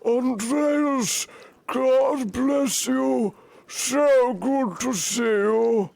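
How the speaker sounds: high and slow with a warm welcome; [name]'s special voice. Acted. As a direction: stretch every word in a high, sing-song old monk voice. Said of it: "I've been saving a voice for you".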